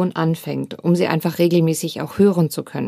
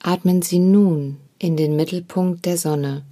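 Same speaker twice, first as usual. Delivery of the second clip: guided meditation. Acted. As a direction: guide the listener gently into stillness. Leads a meditation.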